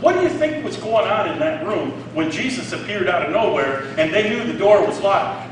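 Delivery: usual speaking voice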